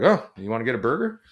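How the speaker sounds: light, fast, breezy throwaway tone, faintly mocking; mock-casual shrug. Boss mocking how cops shrug off suffering, breezy. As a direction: toss it off as if nothing happened.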